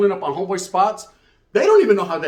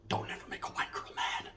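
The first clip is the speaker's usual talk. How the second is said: a hushed, voiceless aside; whispered warning; 16 dB quieter than usual. Real, not acted. A comic warning to the audience, whispered. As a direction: lean in and warn them in a whisper.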